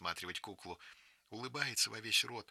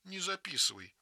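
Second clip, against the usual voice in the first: put-on higher, childlike begging; girl begging a friend to stop; pleading; 7 semitones higher than usual. A narrator's reading. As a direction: plead with her not to write it. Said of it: "умоляет Вера".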